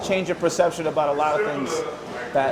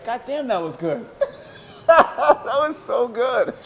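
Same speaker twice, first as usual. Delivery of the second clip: laughing; 9 semitones higher, 4 dB louder, slower than usual. Real, not acted. Laughing after the promo bit.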